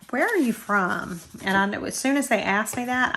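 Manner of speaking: ordinary talk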